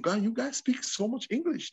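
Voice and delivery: imitated surprised visitor. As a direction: wonder aloud as a visitor. Visitors' remark retold.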